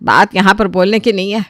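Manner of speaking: plain reading voice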